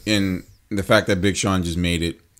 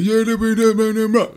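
[name] voice; 13 semitones higher, 3 dB louder than usual. Retold or imitated. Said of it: "that voice where it's like".

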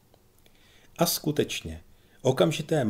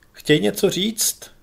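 stern commander; 10 semitones higher, 7 dB louder, slower than usual. A narrator's reading. Commander challenges a heckler.

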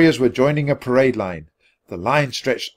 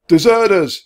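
loud, high accusing cry; shouted alarm; 9 semitones higher, 9 dB louder than usual. A narrator's reading. Someone spotting Jack and Annie, shouting. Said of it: "someone shouted".

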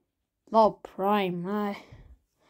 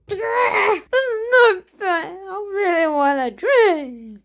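mock crying whine; 6 semitones higher than usual.

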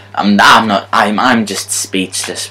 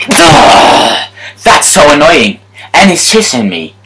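annoyed groan; 5 semitones higher, 4 dB louder than usual. Real, not acted. Annoyed at opponent.

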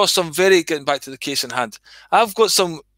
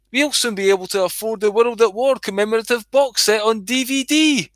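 much higher, shrill-free prim mother voice, pearl-clutching; scandalised mum; 6 semitones higher than usual. Retold or imitated. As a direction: play the outraged churchgoing mum, pitch up high. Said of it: "She said".

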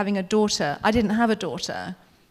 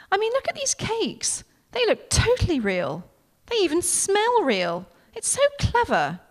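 gushing; 9 semitones higher than usual. Acted. Becky gushing over the cakes.

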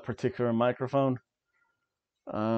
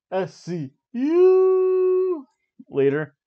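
slow, much higher and louder, stretched like a ring announcer; announcer call; 7 dB louder, much slower than usual. Pretend. Announcing the next team.

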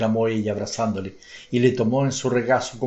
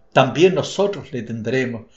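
usual speaking voice

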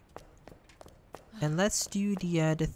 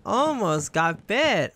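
relieved; 5 semitones higher, 6 dB louder, much slower than usual. Real, not acted. Relief after escape.

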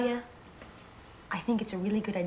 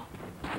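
shocked gasp; 11 dB quieter than usual. Acted. Gasp seeing Robert hurt.

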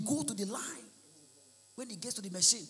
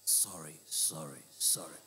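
much lower, quieter mock apologetic rich man; mock apology; 13 dB quieter, slower than usual. Rich man apologizing to the poor, much lower and quieter. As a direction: drop way down and murmur the sorries. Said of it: "he go come dey apologize to the poor".

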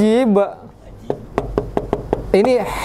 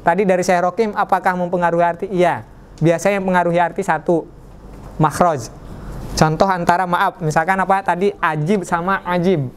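ordinary delivery

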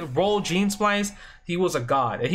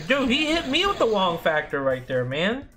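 indignant disbelief; 7 semitones higher than usual. Real, not acted. Gamer telling viewers Magneto just mauled him, indignant disbelief.

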